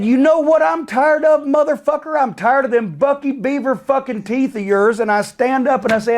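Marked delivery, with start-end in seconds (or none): 0.0-5.0 tearing into him across the table; angry retort. Retold or imitated. Retold blow-up at a meeting.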